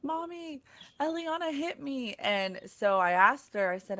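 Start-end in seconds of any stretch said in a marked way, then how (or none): 0.0-2.1 higher and softer, a hurt little tattling whimper; imitating a crying son. Mother imitates her son crying to her, a higher, softer whimper. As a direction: mimic a teary boy telling on his sister. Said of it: "he's crying, like real tears".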